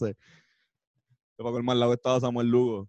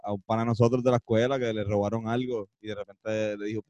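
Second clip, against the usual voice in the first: normal talking voice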